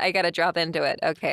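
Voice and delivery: easy, conversational General American; general American accent. Shown as a demonstration. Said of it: "this is general American".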